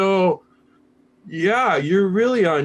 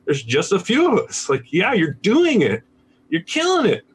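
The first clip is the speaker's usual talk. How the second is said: higher-pitched, emphatic mock pep talk; retold hearty encouragement; 6 semitones higher than usual. Retreat teacher reassuring a student, playfully enthusiastic. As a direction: act out an elder cheering on a student. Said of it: "And they're like".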